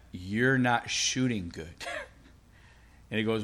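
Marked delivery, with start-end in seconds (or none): 0.1-1.7 slow, low repeated put-down; drawn-out, low and soft insistence; insistent verdict. Retold or imitated. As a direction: imitate the coach insisting, word by word. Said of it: "I kinda laughed at him".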